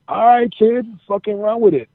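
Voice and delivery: high-pitched voice of backing colleagues; imitated veterans' go-ahead. Veterans give the wrestler their blessing, high imitated go-ahead. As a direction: imitate an old hand waving the kid on. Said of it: "They're like".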